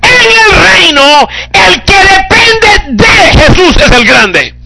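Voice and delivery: high, loud, slow proclamation; slow preaching climax. Acted. Preacher proclaims the kingdom to the church, high and slow.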